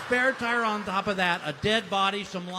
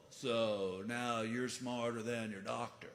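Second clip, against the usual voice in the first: slow stoner voice; 6 semitones lower, 7 dB quieter, slower than usual. Retold or imitated. Stoner kid voiced slowly.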